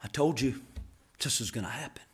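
very low, soft aside; barely voiced, with a pause midway; hushed quote. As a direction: say it quietly, just to one person. Voices Jesus looking back at Peter.

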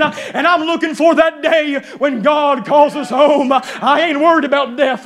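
full-force sermon delivery, driving phrase after phrase; fervent preaching. Acted. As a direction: preach it with rising conviction to the congregation.

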